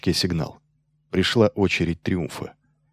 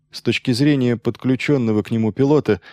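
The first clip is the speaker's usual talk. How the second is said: ordinary delivery, nothing marked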